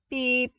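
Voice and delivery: sharp high electronic beep; insistent datapad beep; imitated beep. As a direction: chirp it like a datapad alarm. Datapad alarm repeats.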